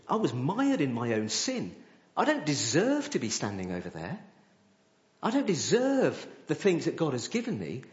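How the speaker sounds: ordinary delivery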